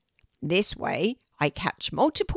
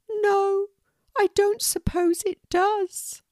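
high, slow, sorrowful little pelican voice; a heartbroken pelican, much higher and drawn out; sad pelican Moon; 14 semitones higher, 5 dB louder, slower than usual. Acted. Moon tells Kelty how sad she feels, high and slow.

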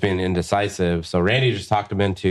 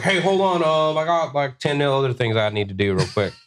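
mock busy friend; 7 semitones higher than usual. Imitating friend brushing clients off.

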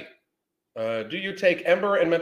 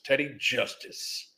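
slow, drawn-out announcer name; lightly voiced old-timey announcer; old-time sheriff show voice; 4 dB quieter, slower than usual. Shown as a demonstration. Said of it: "I don't know why I said that like that".

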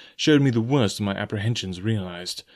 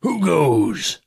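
sharp, loud, higher challenge; Silver's cry; 6 semitones higher, 4 dB louder than usual. A narrator's reading. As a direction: bark the challenge into the dark. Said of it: "with a mighty oath, the voice of Silver cried".

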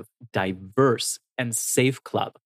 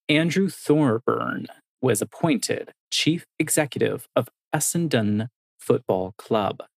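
normal talking voice

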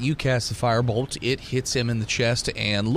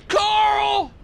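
high, loud yelled call; shouted name, far higher and louder; guard shouting a name; 5 dB louder than usual. Acted. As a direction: bellow the name across the room. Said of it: "he shouts as he pulls".

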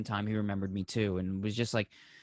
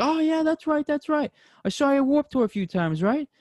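much higher, loud put-on voice; excited singer; 5 dB louder than usual. Singer remembering the fan from Warped Tour, very high put-on voice. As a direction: play the singer, eager and bright with recognition.

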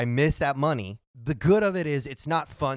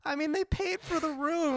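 high sheepish protest; 14 semitones higher, 4 dB quieter than usual. Retold or imitated. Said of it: "And I'm like".

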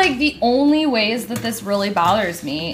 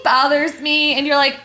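ordinary delivery

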